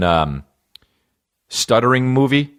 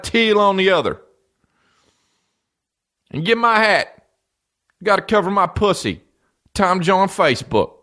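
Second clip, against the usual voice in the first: excited fan; 12 semitones higher than usual. Pretend. Fan voice acting excited about [name].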